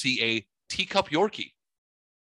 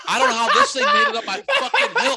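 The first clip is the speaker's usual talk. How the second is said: very high, loud, laughing and fast; 8 dB louder, faster than usual. Real, not acted. Telling his friend about the car, very high and laughing. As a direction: crack up while getting the words out.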